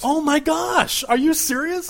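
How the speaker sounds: high, slow, gushing disbelief; excited surprise. Acted. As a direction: squeal it like big news. Winner name just drawn on air.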